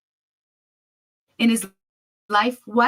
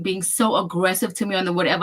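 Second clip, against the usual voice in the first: ordinary talk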